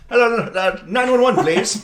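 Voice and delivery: high, loud, breathless plea with a laugh; frantic fifties caller. Pretend. A bystander begging a shopkeeper for the police, high and laughing. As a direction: gasp it out like an old-timey panicked citizen. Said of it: "run into someone's store and go".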